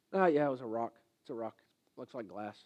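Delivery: low, soft, bored dismissal; dismissive shrug. Acted. Someone downplays the diamond, bored and dismissive. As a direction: brush it off as just a rock. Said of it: "never go tell anybody about it".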